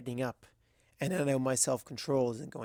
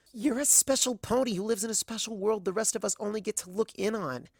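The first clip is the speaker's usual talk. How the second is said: put-on higher, earnest young mare; gentle mare voice; 7 semitones higher than usual. A narrator's reading. Azalea voiced by narrator.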